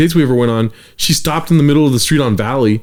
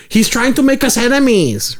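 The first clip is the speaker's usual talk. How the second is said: high-pitched hammed-up imitation; exaggerated girlfriend voice; 9 semitones higher than usual. Girlfriend getting in your ear, exaggerated girlfriend voice. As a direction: overdo her complaint way up high. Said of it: "that's exaggerated".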